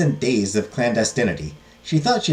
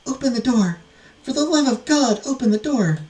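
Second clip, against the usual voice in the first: high-pitched pleading at the door; acted damsel in distress; desperate plea; 13 semitones higher than usual. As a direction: beg to be let in, voice pitched way up. Maidens banging, pleading at door.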